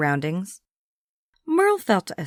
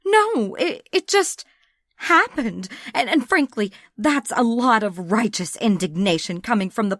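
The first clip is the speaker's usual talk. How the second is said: high, stammering defensive voice; stumble, then push back indignantly; flustered young woman; 3 semitones higher than usual. Acted. Abigail at gunpoint turns it back on Sam, flustered.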